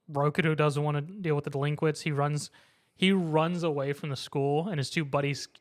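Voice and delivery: ordinary delivery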